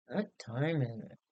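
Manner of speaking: low, sleepy mumble from the pillow; half-asleep, barely getting the words out; drowsy mumble. Acted. Husband in bed asking the time, drowsy mumble. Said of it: "who mumbled drowsily".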